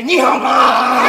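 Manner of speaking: Ni hao ma slow and loud with a laugh; hammed-up wrong-tone Ni hao ma. Shown as a demonstration. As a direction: blare Ni hao ma in a comically wrong tone. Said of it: "or you can't say".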